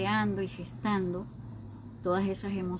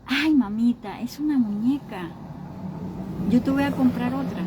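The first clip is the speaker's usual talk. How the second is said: higher, raised voice soothing a crying daughter; consoling mother; 5 semitones higher, 4 dB louder than usual. Retold or imitated. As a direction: promise her another doll, coaxing. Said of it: "y yo decía". (madre consolando a su hija que llora)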